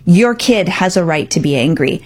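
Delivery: ordinary talk, nothing marked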